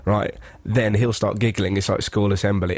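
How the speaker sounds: ordinary talk, nothing marked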